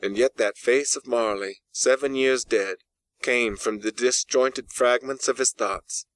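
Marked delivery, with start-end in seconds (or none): none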